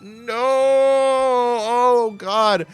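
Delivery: high, drawn-out cry of despair; long, high-pitched groan of dismay; dismayed wail. Real, not acted. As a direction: wail it as defeat closes in. Losing the game to direct damage.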